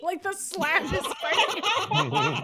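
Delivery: high, loud laughing through words; can barely get it out for laughing. Real, not acted. Picturing the slab fighting Cyclops, laughing hard.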